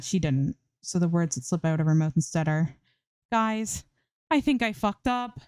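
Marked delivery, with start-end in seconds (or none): 3.3-5.4 higher, uneasy admission; nervous Zoe owning up to friends; sheepish confession. A narrator's reading. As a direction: blurt out that you messed up.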